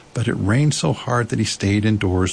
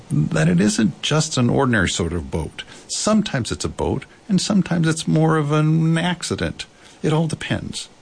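lightly voiced storybook bear, mostly higher; explaining Pooh bear; 7 semitones higher than usual. A narrator's reading. Pooh explains his boat.

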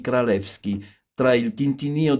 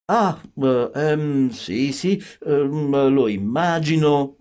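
flustered, halting stammer with ums; mormorio allarmato; 3 semitones higher, much slower than usual. A narrator's reading. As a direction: hem and haw, taken aback. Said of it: "mormorò lui, dandomi un'occhiata un po' allarmata". (esitante e imbarazzato, un po' allarmato)

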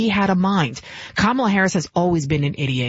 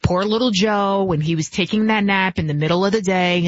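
ordinary delivery

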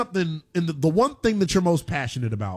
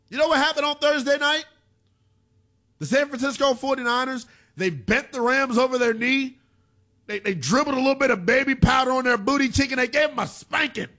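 high-pitched, gloating sports rant; gleeful taunt; 9 semitones higher than usual. Real, not acted. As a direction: rub the beating in, pitched well up. Taunting a Rams fan on air.

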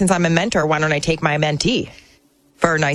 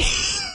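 high squealing burst of laughter; shrieking laugh; 5 dB quieter than usual. Real, not acted. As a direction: let out a shrill shocked laugh.

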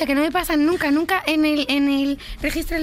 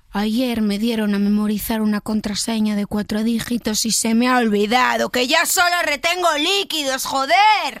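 building to anger. Acted. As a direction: build steadily and explode on the last word. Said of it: "del susurrado al enfadado". (de contenido a enfadado, cada vez más fuerte)

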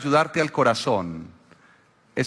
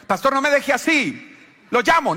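begging friend, high; 9 semitones higher than usual. Retold or imitated. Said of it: "Le dije".

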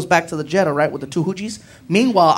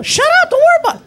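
very high, loud, fast outburst; mock shriek back; 5 dB louder, faster than usual. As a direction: shriek it to cut off the nagging friend. Acted shouting match with friend.

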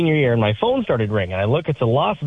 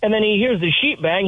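usual speaking voice

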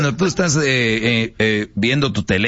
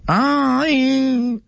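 high, shrill-pitched swooning ay; exasperated sigh; 14 semitones higher than usual. Acted. Girlfriend reacts to a forced te amo, high mock ay.

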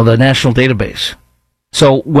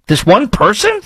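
incredulous; 9 semitones higher than usual. Real, not acted. Incredulous host reacting.

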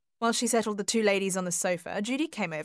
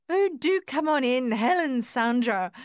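higher, louder hostess greeting; raised, eager welcoming call; crying out in welcome; 5 semitones higher, 4 dB louder, slower than usual. A narrator's reading. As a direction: call the guests in from the doorway. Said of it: "Myrna cried out".

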